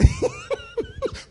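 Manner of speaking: a short, high burst of laughter; high mocking laugh. Real, not acted. Preacher laughs at the played clip, high and mocking. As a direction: laugh at what they just heard.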